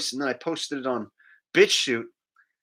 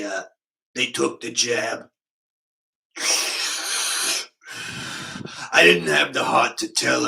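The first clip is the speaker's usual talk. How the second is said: Richie from Boston impression; 2 semitones lower than usual. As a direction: do the grumbling truck-couch truther. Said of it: "the world famous impression".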